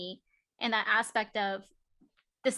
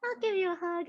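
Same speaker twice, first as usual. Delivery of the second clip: sweet fairy voice; 10 semitones higher than usual. Retold or imitated. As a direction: coo at the child like a gentle fairy. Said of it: "I'm like".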